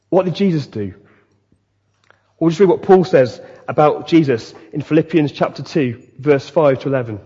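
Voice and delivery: ordinary talk, nothing marked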